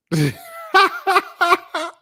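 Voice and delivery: long, high laughing fit; real laughter. Real, not acted. Storyteller cracking up at his snapback outfit, high laughter. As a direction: burst out laughing, high and loud.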